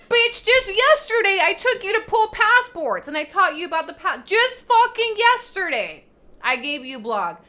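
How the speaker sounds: exasperated yelling. Real, not acted. Vlogger yelling back at viewers who complain, furious. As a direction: blast it out, fed up and indignant.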